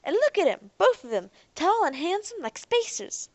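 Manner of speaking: high, piercing call; shrill woman calling to Jake; woman egging Jake on, high and shrill. Acted. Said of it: "said one of the women shrilly".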